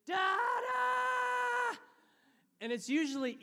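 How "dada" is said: put-on very high, loud child's scream. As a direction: imitate a child screaming from a nightmare. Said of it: "to the scream".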